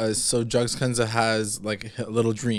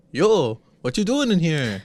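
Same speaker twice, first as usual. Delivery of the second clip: loud, higher casual bro voice; 6 semitones higher, 5 dB louder than usual. Retold or imitated. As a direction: call it out like a jock. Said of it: "Archie's like".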